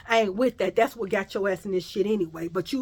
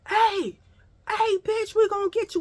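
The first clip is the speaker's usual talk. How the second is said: much higher, called-out threat from down the street; retold taunting shout; 11 semitones higher than usual. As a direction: yell it across the block to scare her. Rival girls calling out, threatening.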